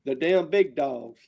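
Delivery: ordinary delivery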